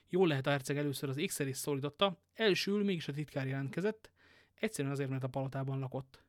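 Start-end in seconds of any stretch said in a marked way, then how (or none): none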